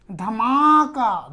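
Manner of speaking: धमाका stretched very slowly, falling at the end; teacher sounding out धमाका slowly; slowly sounded out. Shown as a demonstration. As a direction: draw out धमाका so its ending आका is clear. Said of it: "धमाका आ रहा है".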